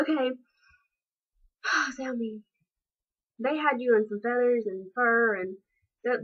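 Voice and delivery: usual speaking voice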